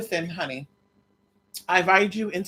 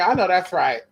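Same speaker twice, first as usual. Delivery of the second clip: normal talking voice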